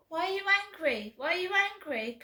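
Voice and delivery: high, fast mocking voice; mocking pranksters. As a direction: mimic them, quick and high. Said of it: "You turn round".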